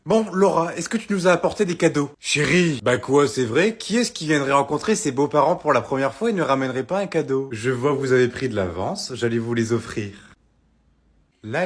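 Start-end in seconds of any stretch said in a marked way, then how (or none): none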